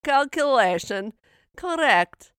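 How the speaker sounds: put-on loud, high Russian-accented computer readout; slow, proclaimed mock Russian accent. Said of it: "and then a graphic goes".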